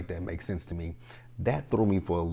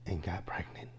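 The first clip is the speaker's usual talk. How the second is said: whisper; 7 dB quieter than usual. Real, not acted. Scandalised aside to viewers.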